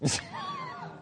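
a sudden high laugh; burst of laughter. Real, not acted. Pastor laughing at his young son's confession.

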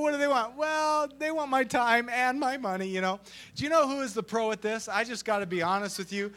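usual speaking voice

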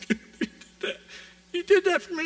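imitated sobs breaking into a high, loud question; imitated crying. Tracy at the checkout learns of the car, imitated tearful disbelief. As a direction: choke the words out as if weeping, then burst high. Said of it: "She starts crying".

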